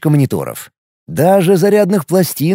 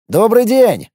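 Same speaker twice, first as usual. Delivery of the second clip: cheerful greeting; 6 semitones higher, slower than usual. Acted. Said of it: "поздоровался я".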